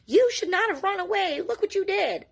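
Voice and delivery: high, loud mock scolding; mom yelling. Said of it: "Please don't yell at her".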